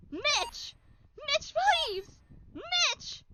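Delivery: high desperate calling out; desperate pleading. Acted. Jackie calling after Mitch, desperate high pleading. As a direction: plead high, begging him to stay.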